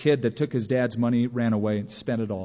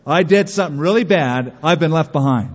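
louder, alarmed inner cry; panicked thought; 2 semitones higher, 7 dB louder than usual. Retold or imitated. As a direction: sound suddenly afraid you missed the rapture. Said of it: "I panicked".

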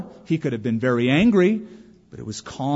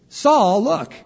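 high, louder call to get attention; lifted, higher-pitched hail; acted calling out; 10 semitones higher, 6 dB louder than usual. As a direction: call out to Saul in a raised voice. David calls out to Saul.

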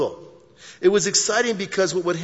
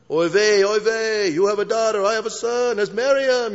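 high mock Yiddish accent, louder; 6 semitones higher, 3 dB louder than usual.

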